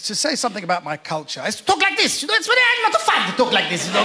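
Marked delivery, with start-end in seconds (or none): 1.7-4.0 high-pitched Middle Eastern accent; comic Middle Eastern accent, lifted high; put-on Middle Eastern accent. Shown as a demonstration. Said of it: "I used to start with an accent".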